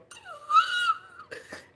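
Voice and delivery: sharp high gasp for air; startled gasp at thick air; big gasp. Retold or imitated. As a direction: gasp like the heat hit you. Gasping at Puerto Rico humidity.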